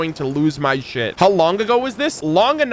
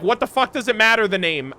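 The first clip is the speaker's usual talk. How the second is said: normal talking voice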